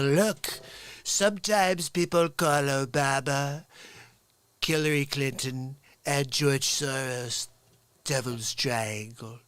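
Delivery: slow, measured mock-president delivery; presidential impersonation. President explaining a nickname for opponents, slow impersonation. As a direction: slip into the president voice, drawing it out.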